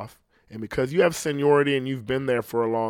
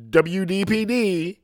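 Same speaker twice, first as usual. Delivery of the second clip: slow, high, puffed-up radio announcer; mocking the fake on-air voice he can't stand; douchebag radio DJ voice; 5 semitones higher, 4 dB louder, slower than usual. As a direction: sell the station ID like a smug morning DJ. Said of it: "that douchebag voice".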